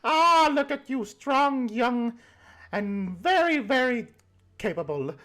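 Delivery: put-on high, slow sweet old lady greeting; old granny voice. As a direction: coo like a doting grandma pinching cheeks. Granny praises player's strength.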